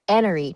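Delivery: "enery" said with no H sound, starting on the vowel; dropped H. Shown as a demonstration. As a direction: drop the H in "enery". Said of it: "accent that's an".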